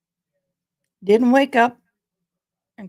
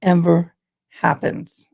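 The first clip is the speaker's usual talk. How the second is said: emphatic; 2 semitones higher, 10 dB louder, slower than usual. Real, not acted. Emphatic repeat after song.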